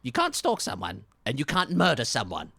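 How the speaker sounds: loud sharp retort; angry detective. Acted. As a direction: shut down the excuse.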